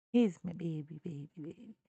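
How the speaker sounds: babbled pet talk sinking away. Real, not acted. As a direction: drop low and soft, cooing over the dog. Baby talk to the dog.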